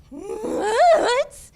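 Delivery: high, loud stunned cry of disbelief; shocked what. Retold or imitated. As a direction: jolt up into a loud incredulous what. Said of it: "I was like".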